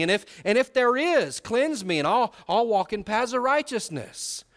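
fervent preaching of David's prayer; impassioned preaching. Acted. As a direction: build the plea like a revival sermon. Rising to preaching pitch.

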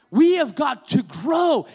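slow, loud preacher's cry; preaching shout. Real, not acted. Pastor urging the congregation to grow, slow shout. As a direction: drive it home, slow and shouted. Pastor driving home point.

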